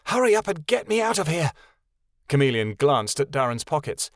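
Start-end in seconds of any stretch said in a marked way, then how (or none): 0.0-1.5 higher, urgent demand; girl urging Darren to free her; impatient girl. A narrator's reading. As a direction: hurry him up, urgently. Chameleon voice.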